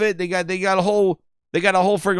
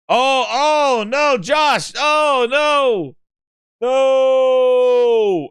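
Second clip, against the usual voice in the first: long, louder drawn-out no; dismayed shout; 7 semitones higher, 4 dB louder, much slower than usual. Real, not acted. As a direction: wail it out in dismay. Dismay at announcement.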